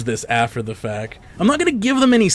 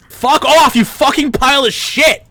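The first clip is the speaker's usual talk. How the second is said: screaming, furious cursing, much higher; swearing tirade; 9 semitones higher, 5 dB louder than usual. Real, not acted. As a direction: explode at the screen in rage. Raging at enemies in game.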